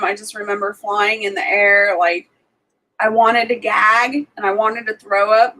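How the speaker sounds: normal talking voice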